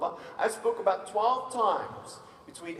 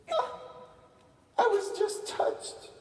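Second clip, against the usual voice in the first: high, drawn-out imitation of crying; tearful mock reply; slower than usual. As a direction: slowly squeak it like a tearful girl. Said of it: "Why are you crying?".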